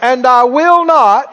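ringing call-and-response proclamation; out-loud declaration. Shown as a demonstration. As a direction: shout it out for the congregation to repeat. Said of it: "Everybody said out loud".

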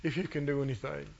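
low, quiet mock plea; mild pleading. Father begging Jesus for his son, mildly pleading. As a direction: plead softly, understated. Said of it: "And he pleads".

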